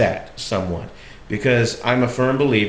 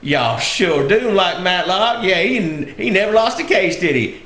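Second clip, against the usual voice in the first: Southern dialect; 9 semitones higher than usual. Retold or imitated. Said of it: "I was using that dialect".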